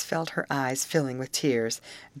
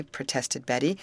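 plain reading voice

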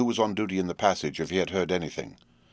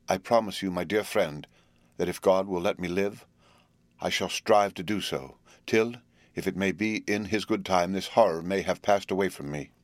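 ordinary delivery

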